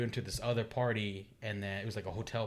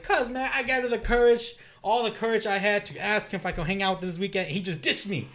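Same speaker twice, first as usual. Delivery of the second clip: louder, higher, aggrieved complaint; angry retelling; 8 semitones higher, 5 dB louder than usual. Retold or imitated. Telling classmates how his friend ditched him, angry complaint. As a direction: vent like you've just been ditched. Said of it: "So I went to class angry".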